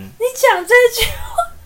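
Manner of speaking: host despairing at the wife's words, whiny wail; exasperated whine. Real, not acted. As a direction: whimper it in disbelief. Host exasperated cry-laugh.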